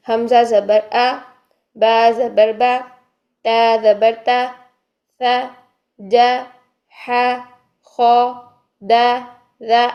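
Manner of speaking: each letter with zabar said slowly, one at a time; recitation drill. Shown as a demonstration. Teacher reciting letters with zabar for students, slowly. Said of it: "ہم اس کو کیسے ادا کریں گے".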